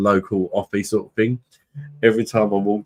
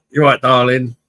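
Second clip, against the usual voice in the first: a higher, louder cheeky hello; cheeky greeting; 6 semitones higher, 6 dB louder than usual. Retold or imitated. Drunk him greeting the shopkeeper, higher cheeky call. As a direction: breeze in with a mock-friendly call. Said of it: "Like that".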